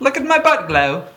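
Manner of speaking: high, loud mock British bug; bad British accent. As the British lightning bug, loud hammed-up British accent. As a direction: show off proudly in a silly British voice. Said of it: "A bad British accent".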